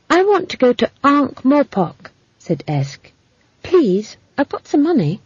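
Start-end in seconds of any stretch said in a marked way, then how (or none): 0.1-2.1 put-on high-pitched voice of an eager child; child's plea. A narrator's reading. Girl asking a caravan boss for passage, pleading. 3.6-5.2 put-on high, pleading little-girl voice; child pleading. A narrator's reading. Girl offering money for passage, pleading.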